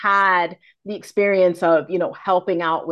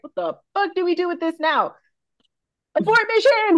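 panicking body; 9 semitones higher than usual. Pretend. Telling new moms how their body reacts, in hammed-up panic. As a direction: voice the body freaking out, ramping up to the alarm.

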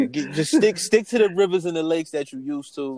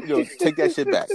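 higher, laughing mock refusal; 11 semitones higher than usual. Telling his cohost what he said, laughing. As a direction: giggle while pushing it back.

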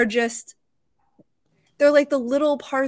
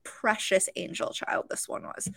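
soft, breathy whisper of awe; hushed whisper; 3 semitones lower, 7 dB quieter than usual. Real, not acted. Telling the host about the little girl, a moved whisper. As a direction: whisper it as if she's too precious to disturb.

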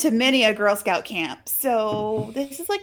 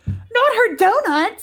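mock horror; 11 semitones higher, 4 dB louder, slower than usual. Mock horror at stolen donuts.

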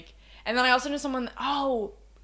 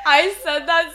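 usual speaking voice

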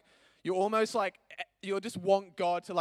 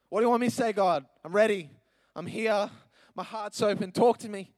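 louder, higher, pleading prayer; earnest plea; 3 semitones higher, 7 dB louder than usual. Retold or imitated. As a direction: beg God to speak to you. Retold pleading prayer to God.

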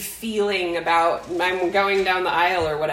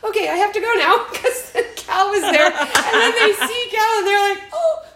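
laughing hard, much higher and louder; 12 semitones higher, 4 dB louder than usual. Real, not acted. Finishing the wedding story for cohost, laughing hard. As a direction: laugh through the whole bit. Laughing through the retold scene.

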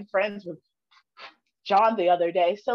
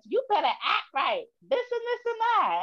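lightly mocked parental scolding, higher pitched; 8 semitones higher than usual. Daughter mimicking parents' lecture, mock scolding. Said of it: "whose voice are you doing when you do that".

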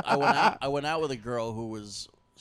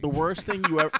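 ordinary delivery

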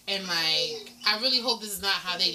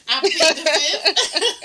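laughing, slow and higher; 9 semitones higher, 8 dB louder, much slower than usual. Real, not acted. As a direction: plead it through laughter. Laughing refusal.

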